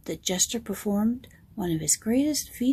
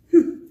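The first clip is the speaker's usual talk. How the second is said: puzzled hmm; 6 semitones higher, 8 dB louder than usual. Acted. Jester puzzled.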